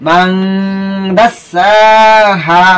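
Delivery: মান held as a long "mang" hum, then long vowels in দাসাহা; ghunnah and long vowels. Shown as a demonstration. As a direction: recite মান দাসাহা slowly, humming the n. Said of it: "এখন শব্দগুলো আমি উচ্চারণ করবো".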